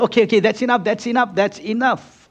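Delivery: a hint of [name] saying enough already; [name] giving in. [name] stops the overpaying friend.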